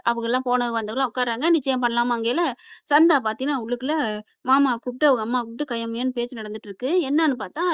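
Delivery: ordinary delivery, nothing marked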